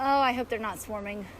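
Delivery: usual speaking voice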